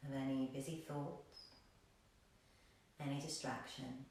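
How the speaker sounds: soft, low meditation voice with long pauses; hushed, calm yoga guide; guided meditation. Real, not acted. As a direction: lull them into stillness.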